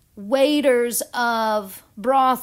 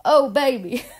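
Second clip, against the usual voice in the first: high, louder delighted gush; delighted oh baby; 5 semitones higher, 6 dB louder, slower than usual. Retold or imitated. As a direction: swoon over Saturn's answer. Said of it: "And I'm like".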